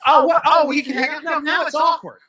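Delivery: high, loud, delighted surprise; excited squeal. Real, not acted. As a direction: burst out in giddy surprise. Surprised squeal at being invited.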